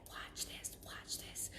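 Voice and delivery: breathy whispered repeat, voice gone. Retold or imitated. Medium relays the spirit's hushed hint in a whisper. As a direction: whisper it like a secret. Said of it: "he's like".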